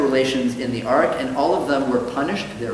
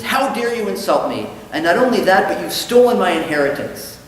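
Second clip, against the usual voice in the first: indignant acted outburst, higher and louder; angry, offended guest; 6 semitones higher, 5 dB louder than usual. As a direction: play the insulted guest accusing the rabbi. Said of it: "the guy gets very angry".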